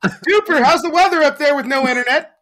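high, loud mock anchor handoff; news anchor voice. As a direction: toss it to the field reporter. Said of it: "like, a news correspondent".

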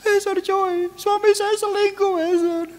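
hammed-up high-pitched plea from a betrayed wife; mock whiny congregant. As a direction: play the petty wife begging the pastor for revenge. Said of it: "Tapi banyak orang".

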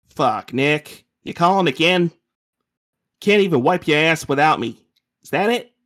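loud, high, irritated cousin; aggravated. Acted. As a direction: snap at him like he woke you. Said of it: "Chenzo sounds aggravated".

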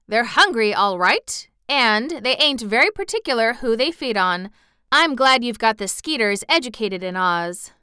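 emphatic, lightly played country aunt; lightly voiced farm aunt. A narrator's reading. Aunt telling the wizard about Kansas flies, lightly emphatic.

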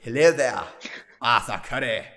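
mock posh British greeting; fake British accent. Mocking actress's line to friend, bad British accent. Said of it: "she's like".

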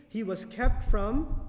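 slow, loud and drawn out, rising on from; slow emphasis. Real, not acted. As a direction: lean on He was kept from, slowly. Stressing meaning of the verse.

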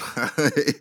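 short laugh breaking off the sentence; guilty laugh. Real, not acted. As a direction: laugh though you know you shouldn't. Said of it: "I shouldn't be laughing".

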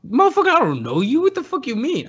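high-pitched retold outburst; mock indignation. He tells a drunk stranger he doesn't know him, mock indignation. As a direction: act out indignant disbelief.

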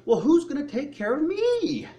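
a worried believer fretting to himself; self-pitying worry. Retold or imitated. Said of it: "We're looking at ourselves going".

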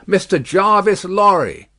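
high, loud announcement of a name; calling out. A narrator's reading. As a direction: call it through the mist. Rider calls name from mist.

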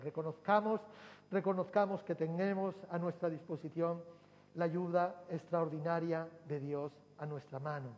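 fervent pulpit exhortation; rising preaching. Acted. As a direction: build the call to the congregation. Preacher builds to full force.

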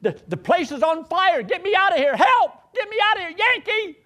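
high, loud frantic cry for help; panicked pleading in tears. Retold or imitated. Dying sister begs her brother to get her out, frantic high cry. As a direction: beg to be pulled out of the fire. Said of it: "she's like in tears".

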